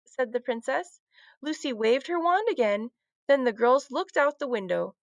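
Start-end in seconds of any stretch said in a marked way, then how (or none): none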